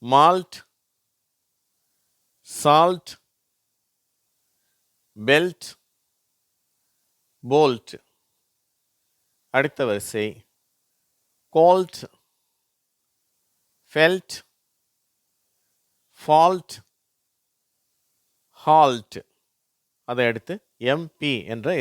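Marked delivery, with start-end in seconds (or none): 0.0-7.9 "மால்ட், சால்ட், பெல்ட், போல்ட்" loud, ending in lt; LT sound demo. Shown as a demonstration. Teacher reading LT examples, "மால்ட், சால்ட், பெல்ட், போல்ட்" loud and separate. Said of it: "இல்ட் அப்படிங்கிற ஒரு சவுண்டை கொடுக்குது". 11.5-19.2 "கோல்ட், ஃபெல்ட், ஃபால்ட், ஹால்ட்" high, loud, ending in lt; LT sound demo. Shown as a demonstration. Teacher drilling LT, "கோல்ட், ஃபெல்ட், ஃபால்ட், ஹால்ட்" high and projected. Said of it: "இல்ட் அப்படிங்கிற ஒரு சவுண்டை கொடுக்குது".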